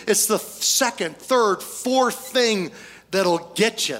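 stretched, drawn-out counting of troubles; preacher slowing down to drive the point home; slow emphatic list. Real, not acted. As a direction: lean hard on each number, slowly. Preacher stretching the key words.

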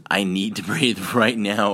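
mock panic for air; urgent demand. Shown as a demonstration. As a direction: insist on air right now with a laugh.

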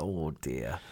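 quiet mock despair; mock dismay. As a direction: sigh it out like a resigned fan. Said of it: "I've got to be like".

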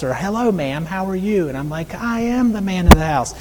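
normal talking voice

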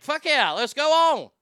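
loud, high, pumped-up yes; eager yes. Retold or imitated. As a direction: shout it like you can't wait. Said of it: "I was like".

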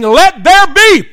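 loud, high, drawn-out proclamation; God's booming command. Acted. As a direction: shout creation into being. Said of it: "It came from God saying".

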